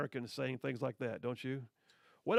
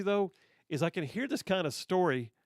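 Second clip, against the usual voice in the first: ordinary delivery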